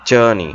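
জার্নি as "JUR-nee"; firm J and no R in জার্নি; silent R. Shown as a demonstration. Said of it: "আর উচ্চারণটা খেয়াল করে উচ্চ".

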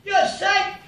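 high, drawn-out shout up a building site; loud high-pitched holler. Acted. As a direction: call up to a worker far above. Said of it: "he shouts up".

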